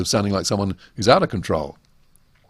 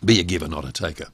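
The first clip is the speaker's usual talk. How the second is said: quick low restatement; 5 semitones lower, 3 dB quieter, faster than usual. Shown as a demonstration. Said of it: "What does that actually mean?".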